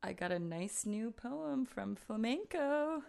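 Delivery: normal talking voice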